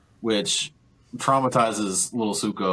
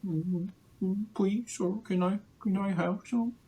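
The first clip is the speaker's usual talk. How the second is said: soft, much higher mock begging voice; pleading little Zuko; 12 semitones higher, 6 dB quieter than usual. Recapper imitates a hungry young ape begging for food, high soft plea. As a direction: beg timidly in a tiny high voice. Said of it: "Zuko's like".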